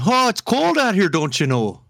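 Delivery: playful Midwestern impression; Midwestern accent. Shown as a demonstration. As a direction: do a hammed-up Midwesterner. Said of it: "I could do the Midwestern".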